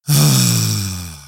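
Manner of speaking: long low sigh of relief; the Rebbe sighing as the barber touches his head, a low relieved sigh. A narrator's reading. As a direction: let out a deep contented sigh. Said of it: "he sighed".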